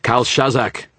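high, loud commanding shout; ringing, forceful command. A narrator's reading. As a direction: shout the name like an order. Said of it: "shouted out in a voice of command".

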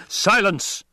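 high, forceful imperial cut-off; angry ruler demanding silence; roared command. A narrator's reading. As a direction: cut him off with a high roar. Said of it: "the Emperor roared".

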